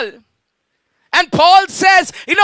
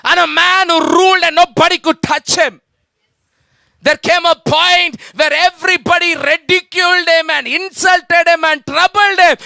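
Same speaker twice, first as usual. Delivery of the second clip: usual speaking voice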